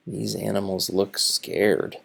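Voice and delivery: usual speaking voice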